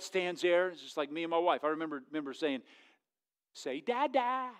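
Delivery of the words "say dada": put-on higher-pitched dad talking to his baby; imitated baby-talk coaxing. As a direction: coax a first word out of an infant.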